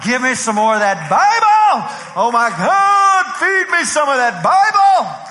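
very loud, high, stretched-out mock pleading; hammed-up eager yelling. New believer pulling on the preacher, hammed-up begging shout. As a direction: holler it like a ravenous, over-the-top new convert.

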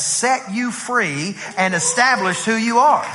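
high-pitched, drawn-out preacher's declaration; emphatic preaching. Real, not acted. Preacher telling congregation of their contract against the devil, forceful preaching. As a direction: proclaim it forcefully, stretching the key words.

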